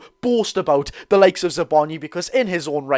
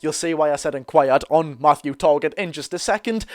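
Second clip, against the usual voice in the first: ordinary talk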